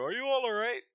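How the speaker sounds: high, loud concerned innkeeper. Acted. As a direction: call out as the worried innkeeper. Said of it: "She looks at you".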